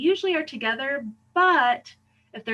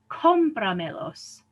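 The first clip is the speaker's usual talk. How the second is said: "cómpramelos" slowly as COM-pra-me-los; stress far back; slower than usual. Shown as a demonstration. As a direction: stretch the first syllable of "cómpramelos". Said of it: "emphasize the fourth".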